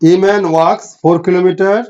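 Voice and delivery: very slow, each word spaced out for dictation; slow it right down so students can keep up; deliberate dictation pace; slowly. Shown as a demonstration. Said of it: "আস্তে আস্তে বলছি".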